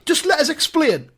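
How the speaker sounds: high-pitched, urgent appeal; pleading. Acted. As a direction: beg for a chance to explain. Pleading to be heard.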